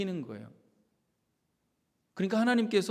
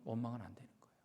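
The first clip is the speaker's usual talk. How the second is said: hushed, softer warning; whispered warning; 4 semitones lower, 11 dB quieter, much faster than usual. Real, not acted. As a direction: drop to a whisper to warn the congregation. (속삭이는 경고)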